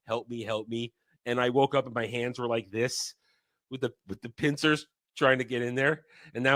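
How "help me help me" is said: quiet, lower mumbled help me; sleep-talking plea. Retold or imitated. Cohost retelling sleep-talking, a quiet low plea. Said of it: "I was actually going".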